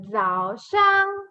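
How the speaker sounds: "Zhao Shang" high and drawn out; model pronunciation. Shown as a demonstration. Teacher invites say with me, high held "Zhao Shang". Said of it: "Say with me".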